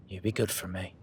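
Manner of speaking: usual speaking voice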